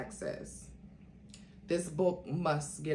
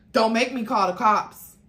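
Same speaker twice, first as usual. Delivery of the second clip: loud, higher sharp warning; 5 semitones higher, 10 dB louder than usual. Acted. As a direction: threaten him, loud. Threatening an aggressive man.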